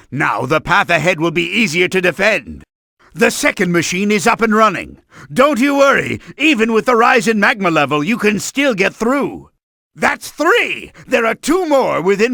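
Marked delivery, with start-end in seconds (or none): none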